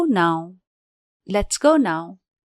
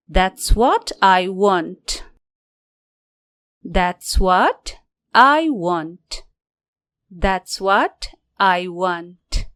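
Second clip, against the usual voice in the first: slow, careful teacher model; slow demo; 3 semitones lower, slower than usual. Shown as a demonstration. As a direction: spell it out slowly for learners. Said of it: "यसरी भन्नुस्". (धीमा, साफ़ उच्चारण वाला नमूना)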